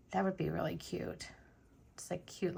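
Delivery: soft unvoiced whisper. Real, not acted. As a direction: breathe it quietly to yourself. Crafting aside to viewers.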